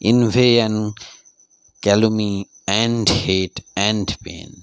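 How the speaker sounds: each phrase read out very slowly; slow, measured reading of quotations; slow recitation. Shown as a demonstration. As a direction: recite the quotes slowly for the class. Reads example lines slowly.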